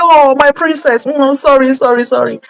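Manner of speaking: soothing parent. Acted. Parent comforting a crying little girl, soothing and high-pitched. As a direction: coo over a hurt little daughter. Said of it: "the girl comes crying to you".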